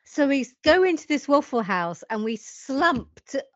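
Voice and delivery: normal talking voice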